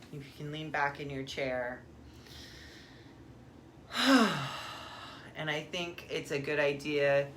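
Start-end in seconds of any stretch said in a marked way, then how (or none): 1.8-5.3 big audible exhale; long sigh. Real, not acted. Guide settles in before the meditation, long sigh. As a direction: let out one long, breathy sigh.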